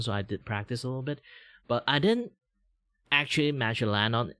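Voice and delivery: ordinary talk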